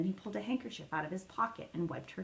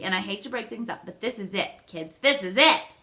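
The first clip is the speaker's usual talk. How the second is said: quivering with excitement; 8 dB louder than usual. A narrator's reading. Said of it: "His voice quivered with excitement".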